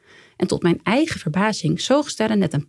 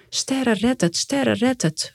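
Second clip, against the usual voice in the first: higher, repeated chant without added volume; understated primal plea, pitched up; calling out; 5 semitones higher, slower than usual. A narrator's reading. Mother pleads for her baby to pull through, mild call. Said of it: "roep ik".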